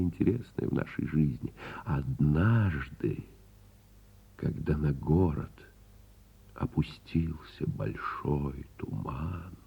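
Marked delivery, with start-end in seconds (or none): 1.8-3.2 однажды drawn out very slowly; однажды, slowly stretched; slow, savored word. Acted. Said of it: "это великолепное слово". 6.5-9.5 slow whisper about the fog; a storyteller's slow, hushed whisper. A narrator's reading.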